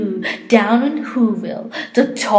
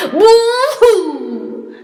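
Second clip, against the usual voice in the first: very high, loud mocking cry; mock wail; 10 semitones higher, 4 dB louder than usual. A narrator's reading. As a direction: mimic the Whos' wail with glee. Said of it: "will all cry".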